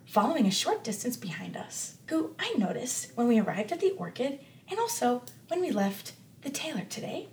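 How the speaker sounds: hushed, discreet warning, partly whispered; whispering. Acted. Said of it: "Winnie's whispering this".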